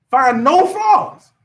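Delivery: high, very slow, each word stressed; emphatic preaching. Real, not acted. Preacher praising a strong leader, slow and emphatic. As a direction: drive the words home slowly.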